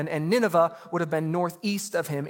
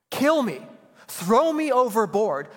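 much higher, louder dramatized cry to die; Jonah's despairing plea; 5 semitones higher, 5 dB louder, slower than usual. Retold or imitated. Pastor acting suicidal Jonah begging sailors, higher and louder.

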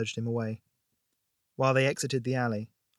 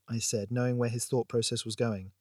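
plain reading voice

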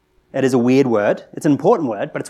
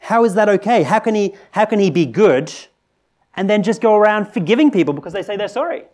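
higher and louder, indignant; almost shout the challenge; outraged objection; 7 semitones higher, 4 dB louder than usual. Retold or imitated. Objector confronting the preacher, loud and outraged.

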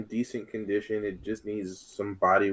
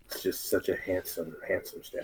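whisper; 7 dB quieter than usual. Acted. Admiring the statue.

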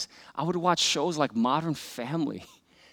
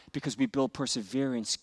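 ordinary talk, nothing marked

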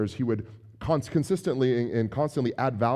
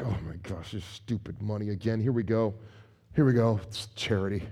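much lower, softer, sulky muttering; grudging grumble; 6 semitones lower, 4 dB quieter than usual. Retold or imitated. Reluctant giver grumbling while writing a check. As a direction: sulk over writing the check.

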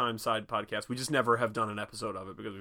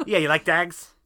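put-on loud, higher Irish Traveller accent, "dags"; Brad Pitt in Snatch; 5 semitones higher, 9 dB louder than usual. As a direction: ham up the thick Irish accent. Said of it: "fully commits to being unintelligible".

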